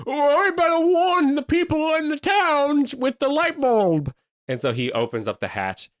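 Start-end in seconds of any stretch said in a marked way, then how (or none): 0.1-4.1 high, loud and slow; loud squeaky alarm; high cartoon voice. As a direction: sing out the warning like a cartoon hero. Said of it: "and he goes".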